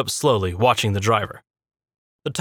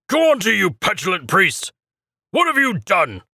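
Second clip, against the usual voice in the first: angry scolding; 8 semitones higher than usual. A narrator's reading. Acolyte scolding the priest in the wagon, high angry voice. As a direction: berate him with contempt.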